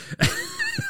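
burst of laughter. Real, not acted. Laughing at his own impression.

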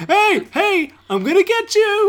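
high squeaky shout; high-pitched yell. Acted. Gorilla yelling at guard.

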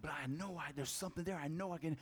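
quiet eagle. Retold or imitated. Little eagle quietly insists he can fly, soft determined murmur. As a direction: insist it under your breath. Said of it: "he's like".

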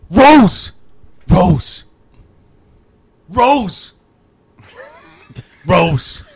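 Jack calling Rose, mock desperate shouts; DiCaprio impression. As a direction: call out for Rose like a drowning man. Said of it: "All right, Leonardo DiCaprio".

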